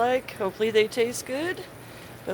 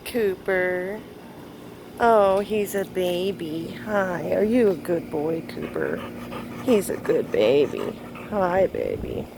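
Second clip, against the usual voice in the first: talking to a dog. Real, not acted. Greeting her dog Cooper.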